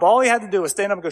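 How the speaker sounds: normal talking voice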